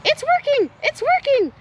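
excited cheer. Acted. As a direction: cheer as the plan works. Dilly cheers in battle.

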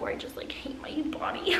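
hushed admission; soft whisper. Real, not acted. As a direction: let it slip out under the breath. Confessing body insecurity.

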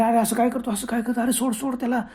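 high, panicked begging; frantic plea. Acted. Sanju begging the ghost to let go, panicked. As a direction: beg him to let go.

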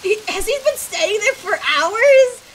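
incredulous. Real, not acted. Gamer reacting to a guilty character's long wait, incredulous. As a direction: draw the question out, high and loud. Shocked reaction to game scene.

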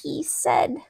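usual speaking voice

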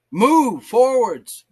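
slow, high, loud command; forceful exhortation. Retold or imitated. Preacher urging the discouraged to go on, loud and forceful. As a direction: cry it out, stretching each word.

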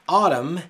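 Autumn said loud with a flapped T and a final M; clear Autumn model. Shown as a demonstration. Teacher introducing Autumn as the word to practise. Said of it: "nos vamos a concentrar en autumn".

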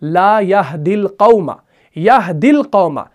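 slow, careful Arabic recitation. Shown as a demonstration. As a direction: read it out slowly for the students. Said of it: "देखिए आप".

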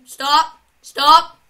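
loud, rising shouts of stop; yelling stop. Real, not acted. Player yelling at the game to stop, loud alarm. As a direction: yell it in alarm.

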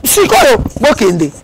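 high-pitched, emphatic mock command; acted urgent order. Someone ordering people out right away, urgent acted command. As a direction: order them out at once, pitch jumping up.

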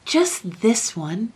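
slow, high, pleading cry; eager cry. A narrator's reading. Young king lays hands on the forbidden book, crying eagerly. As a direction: beg eagerly for one exception. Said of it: "cried Lionel".

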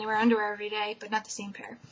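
ordinary talk

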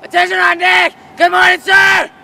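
shouted military greeting; barked military recruit reply. Acted. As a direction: bark the greeting at full volume. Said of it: "Fucking say it".

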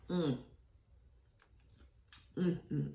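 humming while chewing; savoring food with mouth closed; mouth full, chewing. Real, not acted. Seen 1: Bites rib tip at 0.1, then chews with fingers at lips.

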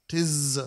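"Tiz" with a long, buzzing voiced z; buzzed Z. Shown as a demonstration. Lecturer showing the proper Zayin in the Shema, buzzed Z. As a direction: hold the z of "Tiz" as a proper buzz. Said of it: "It should be a proper buzz".

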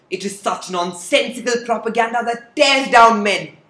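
usual speaking voice

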